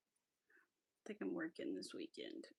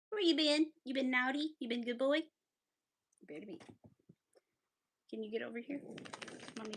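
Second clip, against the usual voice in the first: pet talk; 4 semitones higher, 5 dB louder than usual. Real, not acted. Asking the dog where he has been, in high baby talk.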